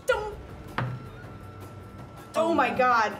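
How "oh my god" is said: ordinary talk, nothing marked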